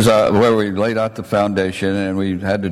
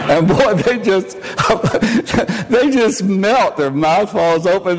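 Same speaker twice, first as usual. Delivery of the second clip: high, slow, giggling; amused high chuckle; laughing through words; 9 semitones higher than usual. Real, not acted. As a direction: laugh as you describe them melting. Laughing while telling reaction.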